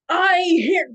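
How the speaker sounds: loud, high, booming knight; big heraldic bellow. Retold or imitated. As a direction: boom it out across a field. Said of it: "booming voice".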